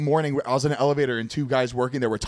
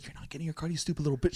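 much softer, sneering quote; muttered mock insult; 10 dB quieter, faster than usual. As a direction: drop the voice for the bartender's jab. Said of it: "She's like".

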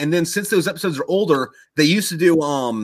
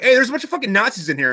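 high-pitched startled outcry; pitched-up indignant discovery; mock alarm; 9 semitones higher, faster than usual. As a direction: act out a soldier tattling in alarm. Soldiers discover the enemy inside.

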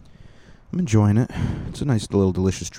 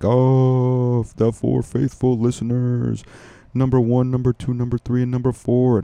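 louder, higher, drawn-out call-out; mock-rousing announcement; 5 semitones higher, 5 dB louder, slower than usual. Podcaster calling out four faithful listeners, mock-rousing announcement. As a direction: hype up the faithful few with stretched words.

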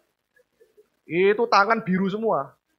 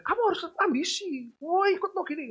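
high pushy parent voice; 11 semitones higher, 4 dB quieter than usual. Retold or imitated. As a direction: press the wife to be ambitious. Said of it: "orang tuanya datang ke istri saya". (orang tua ambisius menekan, nada tinggi)

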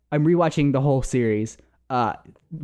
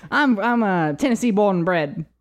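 put-on Tennessee accent; 6 semitones higher than usual. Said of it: "so he speaks Tennessean".

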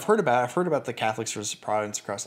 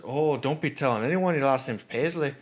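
put-on Irish lilt, pitched up; warning Irish cabbie; 4 semitones higher than usual. Irish cabbie cautioning a tourist about his surname, warning. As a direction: warn him in an imitated Irish brogue. Irish driver warns him.

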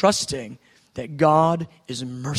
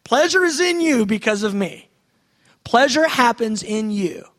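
ecstatic; 6 semitones higher, 7 dB louder than usual. Shown as a demonstration. Acting God's delight, ecstatic.

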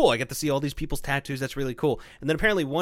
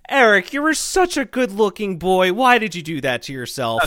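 louder, mock-dismayed lady's reproach; proper lady scolding; 5 semitones higher, 5 dB louder than usual. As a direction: lament it like a proper older lady. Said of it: "she's very proper".

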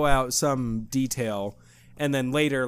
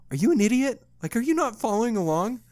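higher, exasperated "are you an idiot"; mock incredulous wife; 8 semitones higher than usual. Wife to husband seeking clarification, mock incredulous. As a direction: play the wife looking down on him. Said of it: "she looks at me like".

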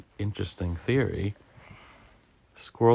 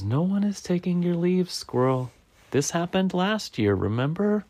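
lightly voiced, higher-pitched bird; reassuring Bird; 9 semitones higher than usual. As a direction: reason patiently in a slightly raised pitch.

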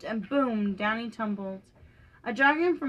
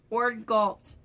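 ordinary delivery

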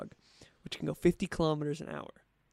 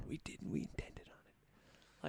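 softer, slow mock whisper; 13 dB quieter, slower than usual. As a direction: whisper a sinister admission. Conspiratorial whispering.

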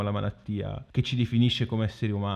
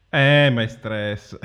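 dismissive doctor; 8 dB louder, slower than usual. Retold or imitated. Doctor dismissing a sick patient.

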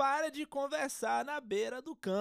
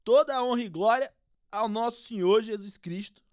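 footballer interview voice; 7 dB louder than usual. Acted. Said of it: "O craque palmeirense".

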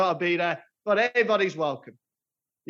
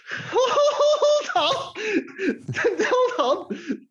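laughing; 12 semitones higher, much slower than usual. Real, not acted. Colleague puts on glasses.

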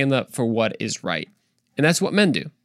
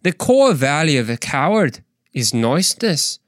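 put-on Scottish lilt; Scottish accent. Speaker tries the same line again, Scottish accent. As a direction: have a playful go at a Scottish accent. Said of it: "let's try a little Scottish".

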